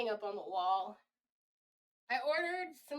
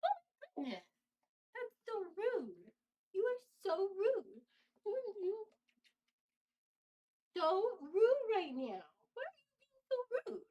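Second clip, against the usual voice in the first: mock scolding baby talk; 8 semitones higher than usual. Owner chiding the rude cat, high mock-offended baby talk.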